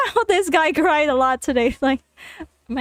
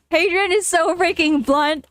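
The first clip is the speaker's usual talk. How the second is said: ordinary talk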